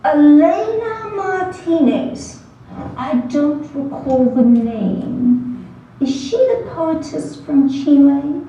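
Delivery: slow, drawn-out pseudo-British puzzlement; a lightly faked British accent, unhurried; pseudo-British professor brushing off the student. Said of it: "in his crisp, pseudo-British accent".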